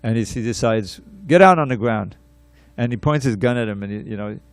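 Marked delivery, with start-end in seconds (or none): 1.3-2.2 loud retold command; acted military order. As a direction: order him down like an officer in charge. Lieutenant orders him down at gunpoint.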